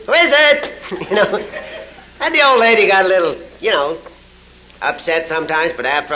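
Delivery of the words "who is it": high parrot squawk of a question; insistent, high parrot call. Acted. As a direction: pipe it up like a parrot. Parrot repeating its three words.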